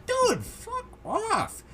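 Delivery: high-pitched, incredulous dismissal; fed-up, squeaky-high brush-off; exasperated. Real, not acted. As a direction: voice leaps up in disbelief and irritation. Exasperated retort to the snarky commenter.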